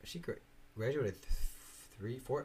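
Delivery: normal talking voice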